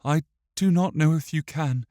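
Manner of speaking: put-on high child's voice, measured; sad boy. A narrator's reading. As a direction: answer as a sad boy, pausing between thoughts.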